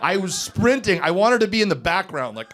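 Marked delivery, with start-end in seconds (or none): none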